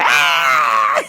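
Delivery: loud mock tantrum screech; imitated child scream. Child rejecting yogurt from mother, loud mock scream. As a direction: screech over the question. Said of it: "she starts screaming".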